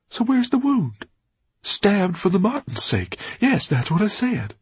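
Orpheus agitated. A narrator's reading. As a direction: demand an answer.

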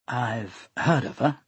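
slow, soft and wary; cautious. Acted. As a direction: say it carefully, giving little away. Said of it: "Flora said cautiously".

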